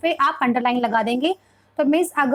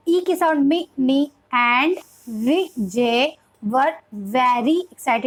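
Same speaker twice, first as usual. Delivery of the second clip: very slow, broken-up beginner reading of Vijay and very; syllable by syllable; much slower than usual. Shown as a demonstration. Teacher reading for a young beginner, syllable by syllable. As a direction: sound out Vijay as vi, jay and very as ve, ry. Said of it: "syllable वे में हमें पढ़ाना चाहिए".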